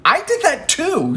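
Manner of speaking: high-pitched "me too" grievance; mock-aggrieved, pitch shooting up; aggrieved protest. Jealous thought acted in retelling.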